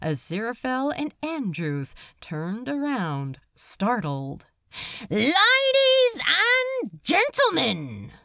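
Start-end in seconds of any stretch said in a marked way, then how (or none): 5.1-8.1 high, loud, drawn-out hail; stretched showman's shout; public announcement. A narrator's reading. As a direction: call the whole room to attention, slowly. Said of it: "said the voice again".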